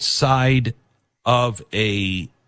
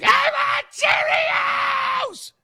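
put-on much higher, slow, wailing child demand; toddler tantrum; much slower than usual. Parent imitating a preschooler melting down in a grocery store, shrill tantrum wail. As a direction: whine it like a kid on the store floor. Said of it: "spread eagle on the floor and they're like".

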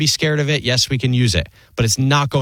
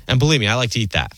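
ordinary delivery

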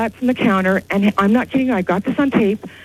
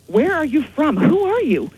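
high, urgent questions, pitch leaping on the second; agitated retold question; 5 semitones higher than usual. Clerk retelling how she questioned the levitating man, agitated. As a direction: demand answers, retelling your alarm. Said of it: "my heart's beating a thousand miles a minute".

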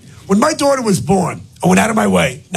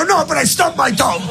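pained protest; 7 semitones higher than usual. Retold or imitated. Dad protests to scolding daughter.

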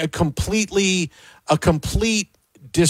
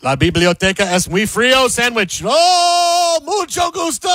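loud nonsense play-by-play rising into long high cries; mock Spanish commentator; 6 semitones higher, 3 dB louder than usual. Host parodies Spanish commentary to sneak in sandwich, loud mock commentator. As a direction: build to high, stretched goal-call vowels.

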